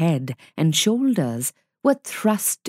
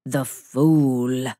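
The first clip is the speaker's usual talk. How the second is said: lightly voiced scornful wolf; 7 semitones lower than usual. A narrator's reading. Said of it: "said Father Wolf".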